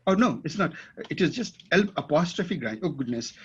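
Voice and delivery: normal talking voice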